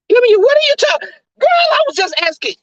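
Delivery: high loud exasperated yell; exasperated shout. Acted. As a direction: yell at her in disbelief. Kimmy shouting at M U.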